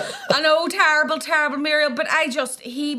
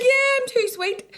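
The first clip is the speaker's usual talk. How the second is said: high, slow, whimpering put-on voice; playful pouting whimper; mock whiny self; 12 semitones higher, slower than usual. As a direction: whine it like a pouting child. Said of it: "I was like".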